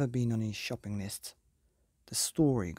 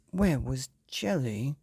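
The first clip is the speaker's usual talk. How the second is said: usual speaking voice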